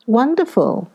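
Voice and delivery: high exclamation of wonder; exclaiming. A narrator's reading. Young lady answers her cousin's boast of reading, exclaiming high. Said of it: "I exclaimed".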